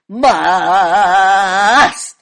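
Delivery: loud, long-held single note; mock drawn-out monotone; elongated word. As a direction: hold the vowel long and hard. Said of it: "Some of us will elongate".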